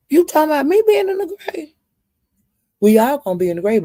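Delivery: high, fast indignant challenge; outraged comeback to Justin; indignant retort. Real, not acted. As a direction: fire it back quick and high. Retorting to Justin's grave remark.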